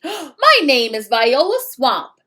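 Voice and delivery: scratchy voice. Acted. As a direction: rasp out her name menacingly. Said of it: "in a scratchy voice".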